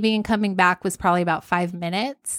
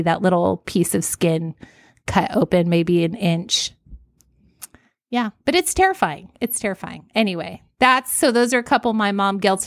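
usual speaking voice

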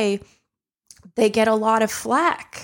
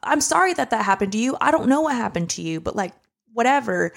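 pitched way up, fast, upset retort; retold outburst; 7 semitones higher, faster than usual. Retelling crying at her drunk relative, shrill-free high upset retort. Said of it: "sobbing and being like".

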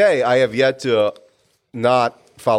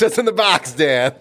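mock anguished shout; 3 semitones higher than usual. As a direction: shout it like the movie scene. Movie quote Se7en.

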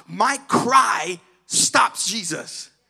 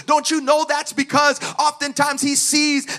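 loud, rising sermon climax; impassioned preaching; 5 semitones higher, 8 dB louder than usual. Real, not acted. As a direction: preach it upward to a high, shouted finish. Preaches at full force.

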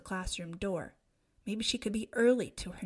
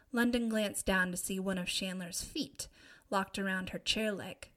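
usual speaking voice

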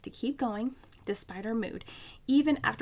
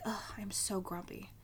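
grumpy; 2 semitones lower, 8 dB quieter than usual. Retold or imitated. Admitting to the girl at the gym counter, mildly grumpy. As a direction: grumble it quietly, mildly put-on. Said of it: "and I'm grumpy".

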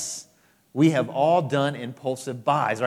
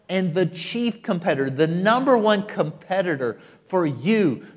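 preacher building the point; building to a climax; 5 semitones higher, 6 dB louder than usual. Acted. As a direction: build and land the last line.

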